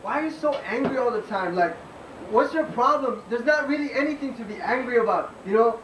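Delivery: spoken-word skit, pitched above normal chat; lightly voiced dismissive nagger. One performer nags another to chill out, lightly acted dismissiveness. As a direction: lightly act an exasperated chill-out type.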